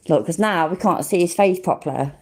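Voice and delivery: usual speaking voice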